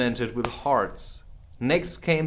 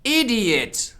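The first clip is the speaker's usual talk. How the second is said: sharp, much higher queen snap; Queen's insult; 11 semitones higher, 5 dB louder than usual. Acted. The Queen snaps at the Knave, high and sharp. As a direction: spit it out at the bowing knave.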